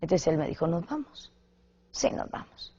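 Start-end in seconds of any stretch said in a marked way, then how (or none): 0.7-1.3 softly asking to leave; quiet retold question. Husband quietly asks his wife to leave the film. 1.9-2.7 hushed whisper of agreement; whispered reply. Retold or imitated. Wife whispers back to her husband in the cinema.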